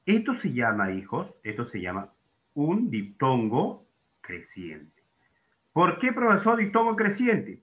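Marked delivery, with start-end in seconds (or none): 5.7-7.6 much higher-pitched questioning pupil; imagined student's question. Acted. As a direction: put on an inquiring student's higher voice. Teacher voices a student's question. (voz aguda de alumno que pregunta)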